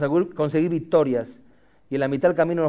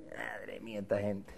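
very low, soft grumble; barely audible mock complaint; muttered exasperation; 9 semitones lower, 21 dB quieter than usual. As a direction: mutter it to yourself. Acted weary muttering about audience.

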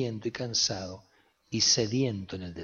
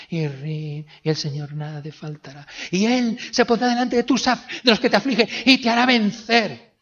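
loud, much higher sing-song preacher; mock typical preacher; 10 semitones higher, 8 dB louder than usual. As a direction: ham up the cliché sermon. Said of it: "Muy pocos predicadores he escuchado una predicación ... la de siempre".

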